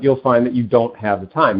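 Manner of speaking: normal talking voice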